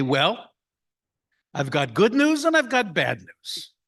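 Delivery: lightly voiced jolly father-in-law. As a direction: set up the punchline like a cheerful old joker. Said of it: "He would say".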